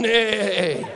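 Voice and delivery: lightly voiced donkey saying "Nay, nay"; mock donkey "nay". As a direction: say "Nay, nay" a bit like a donkey bray, laughing. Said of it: "sounding just like the donkey".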